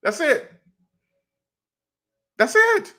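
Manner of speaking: squealing high delight; giddy punchline. Real, not acted. Host delighting in his own punchline, high squealing glee. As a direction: let it jump way up with glee.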